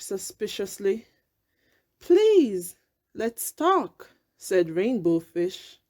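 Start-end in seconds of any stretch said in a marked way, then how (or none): 2.0-4.1 high, earnest plea; pleading. A narrator's reading. Rainbow Fish pleading with the whale to talk. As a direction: coax the whale gently.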